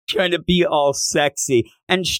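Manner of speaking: ordinary delivery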